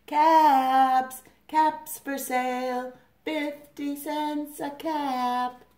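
high, drawn-out sales cry; slow, sustained peddler's call; street vendor calling. A narrator's reading. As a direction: call out wares across the town.

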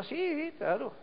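high, drawn-out, easygoing yes; obliging palm tree. Acted. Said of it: "le dice". (palma complaciente, con voz aguda)

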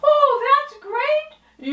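high, fast, bubbly puppet voice; high puppet girl. Acted. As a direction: chirp it like a perky little puppet.

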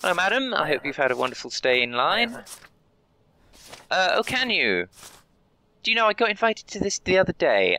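high, sing-song customer-service voice; cheery border clerk. Acted. Guard welcoming a traveller, high and chirpy. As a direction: greet each traveller like a chirpy official.

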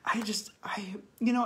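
breaking into a whisper with emotion; words catching in a tearful throat; real tears breaking the voice; choked up. Real, not acted. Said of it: "Sorry, I'm being emotional".

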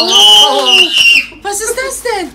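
loud surprised shout; yelling across the room. Real, not acted. Mutter ruft überrascht zurück. (laut überrascht rufen)

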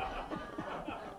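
short unvoiced laugh; a quick breathy chuckle. Real, not acted.

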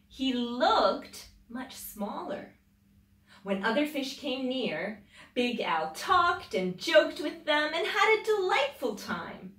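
put-on lively read-aloud voice for children; storybook reading. A narrator's reading. Mother reading a picture book to children, lively storybook voice.

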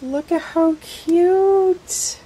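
high, slow gush over something cute. Real, not acted. Unboxing a friend's gift, cooing high and slow.

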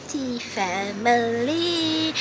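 long-held, high hail to the followers; drawn-out greeting. Real, not acted. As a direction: stretch the greeting right out, calling to your family. Opens the show hailing followers.

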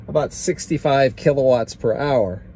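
normal talking voice

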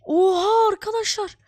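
loud high startled cry; shocked exclamation. Real, not acted. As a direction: gasp it out in shock. Night suddenly falls.

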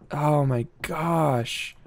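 ordinary talk, nothing marked

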